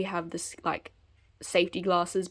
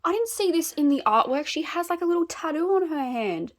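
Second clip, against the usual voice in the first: excited; 8 semitones higher, 4 dB louder than usual. Real, not acted. Excited discovery of tattoo.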